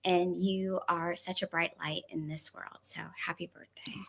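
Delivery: heartfelt wish fading to a near whisper; real tender sincerity. Real, not acted. Heartfelt birthday wish, becomes emotional.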